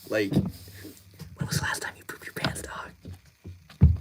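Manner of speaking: unvoiced, breathy whisper of a silly question. Shown as a demonstration. Streamer whispering a chat request to viewers, hushed whisper. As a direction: whisper it close to the mic, as if sharing a secret.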